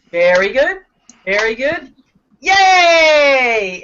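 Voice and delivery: very good, very good, then a loud yay; cheering the class on, building to a shout; cheer demo. Shown as a demonstration. Teacher cheering class: very good, very good, yay. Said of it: "I'm gonna do this. It's called very good".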